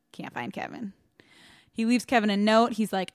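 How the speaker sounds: normal talking voice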